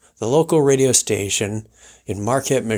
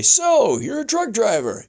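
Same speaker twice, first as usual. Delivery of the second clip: radio host; 10 semitones higher than usual. Retold or imitated. Host imitated welcoming guest, high showman voice. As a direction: roll it out like a ranch host. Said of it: "he would say".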